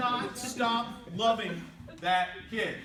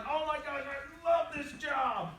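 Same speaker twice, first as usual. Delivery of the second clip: higher, drawn-out mock elation; gushing excitement; 4 semitones higher, slower than usual. New employee loving the job, acted excitement. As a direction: gush with slow, stretched delight.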